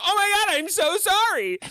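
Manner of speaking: high, loud, panicked sorry; flustered apology. Retold or imitated. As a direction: gush an apology, mortified. Apologizing in retold scene.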